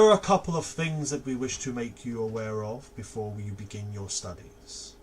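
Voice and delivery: measured, ceremonial welcome speech with slow pauses; formal welcome address. Acted. Headmaster welcoming new students, stately and measured.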